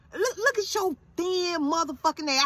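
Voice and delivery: high, drawn-out berating; disgusted scolding. Retold or imitated. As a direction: tear into your son, word by word. Acts out berating son.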